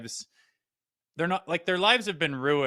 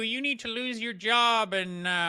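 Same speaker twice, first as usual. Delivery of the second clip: high, put-on whiny scold; mocking cancel-culture voice; 8 semitones higher, 7 dB louder than usual. Mocking the cancel crowd on air, high whiny scold. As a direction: sneer it like an outraged online mob.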